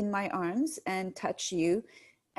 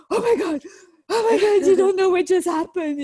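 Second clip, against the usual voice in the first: higher, louder, half laughing; frantic telling; 10 semitones higher, 9 dB louder than usual. Retold or imitated. Mother retelling bursting in on the family, high, loud and half laughing. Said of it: "and I'm like".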